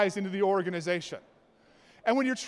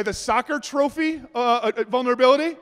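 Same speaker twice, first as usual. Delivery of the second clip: ordinary talk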